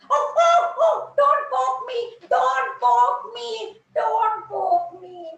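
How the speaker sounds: high, loud and slow, fussy warning; prickly rose. Acted. Rose shrieking at the dewdrop not to poke her. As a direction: squeal it out, drawn long.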